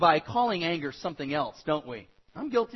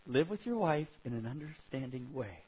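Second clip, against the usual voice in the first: much lower and softer; resigned self-reminder; 8 semitones lower, 7 dB quieter than usual. Retold or imitated. Husband asked again by his wife, quietly reminding himself. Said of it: "And then I went".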